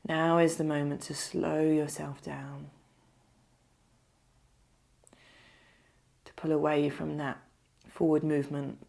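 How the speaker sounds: unhurried meditation voice, leaving space to breathe; guided meditation. Acted. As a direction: lead them gently into stillness, phrase by phrase.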